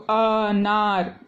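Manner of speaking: अनार stretched out very slowly, syllable by syllable; teacher slowly sounding out अनार; slow demo word. Shown as a demonstration. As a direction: draw out अनार slowly for the class. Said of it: "जैसे कि अनार हम बोलेंगे".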